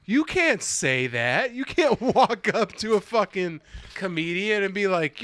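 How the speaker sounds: high, drawn-out disbelief; incredulous squawk of protest; retold appalled protest. Retelling shocked reaction to drunk dad.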